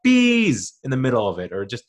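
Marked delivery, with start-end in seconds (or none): 0.0-0.8 a random outburst mid-scene; sudden shout. Pretend. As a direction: blurt it out loud and high. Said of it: "he just shouts out".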